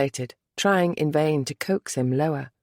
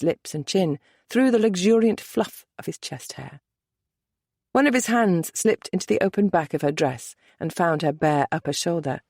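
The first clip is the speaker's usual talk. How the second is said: ordinary delivery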